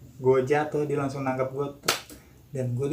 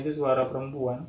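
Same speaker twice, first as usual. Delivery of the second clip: ordinary delivery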